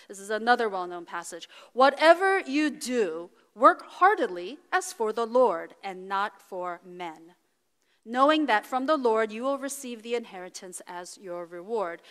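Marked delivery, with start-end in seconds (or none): 1.7-12.0 scripture reading. Real, not acted. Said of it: "Colossians three twenty-three, twenty-four says".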